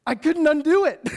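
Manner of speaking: loud, high comic wail; exasperated outburst. Acted. Preacher learning his reputation got there first, exasperated outburst. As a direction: explode in mock despair. Despairs at being known as loud.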